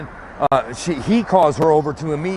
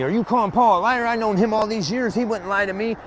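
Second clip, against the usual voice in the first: higher, indignant mock accusation; imitating upset boss; 7 semitones higher than usual. Boss lady defending Paul against him, mock indignant, higher. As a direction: play her as offended and defensive, lightly. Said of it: "Getting upset with me".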